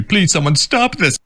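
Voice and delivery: hammed-up plea; mock pleading. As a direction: whine it theatrically. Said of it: "Andy Richter said".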